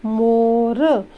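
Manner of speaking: मोर drawn out very slowly and clearly; slow demonstration. Shown as a demonstration. Teacher naming the picture for children, very slowly. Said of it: "जैसे ये क्या है".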